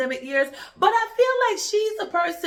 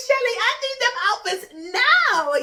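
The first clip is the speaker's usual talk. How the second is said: excited; 9 semitones higher, 3 dB louder than usual. Retold or imitated. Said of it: "I was like".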